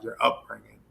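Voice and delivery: ordinary talk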